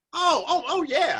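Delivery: very high squealing oh yeah; giddy high-pitched squeal; mock squeal of delight. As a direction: squeal like you just got the news.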